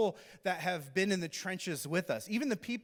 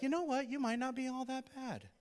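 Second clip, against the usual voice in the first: higher, grudging concession in a put-on voice; grudging widow; 9 semitones higher than usual. Widow grudgingly admitting Elijah is okay, higher put-on voice. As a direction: give in reluctantly. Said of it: "you're like".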